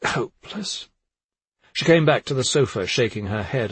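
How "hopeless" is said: high, softer show of exasperation; feigned exclamation. A narrator's reading. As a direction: exclaim it for the others to overhear.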